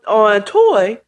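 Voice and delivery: ordinary delivery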